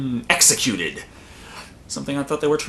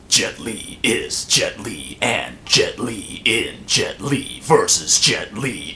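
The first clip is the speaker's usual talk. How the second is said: deep, slow movie trailer announcer; 6 semitones lower, slower than usual. Pretend. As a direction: boom it out like a blockbuster trailer.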